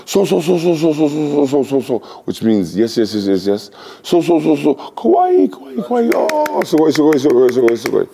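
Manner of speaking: high squealing excitement; excited gushing. Retold or imitated. Japanese lady recognizing him, high excited squeals. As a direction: gush like a thrilled fan.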